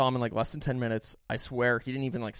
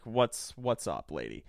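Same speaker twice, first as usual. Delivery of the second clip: slow drawl; 2 semitones lower, slower than usual. Retold or imitated. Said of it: "I'm like".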